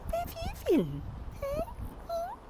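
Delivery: high cooing question to the cat; high pet voice. Real, not acted. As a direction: ask the cat sweetly, way up high.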